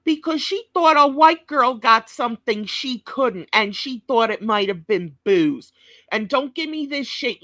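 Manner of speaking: ordinary talk, nothing marked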